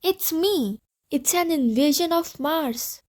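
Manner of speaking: mild mock scream of panic; hammed-up alarmed wail. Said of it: "screaming and holding his head".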